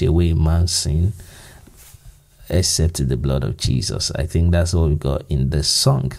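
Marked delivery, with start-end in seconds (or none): none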